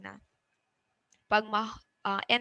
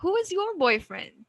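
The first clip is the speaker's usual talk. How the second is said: put-on high early, "boyfriend" falling; rising-falling question; 8 semitones higher than usual. Shown as a demonstration. Teacher models an open question, rising then falling. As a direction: start high and bring "boyfriend" down. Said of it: "not answerable by yes or no".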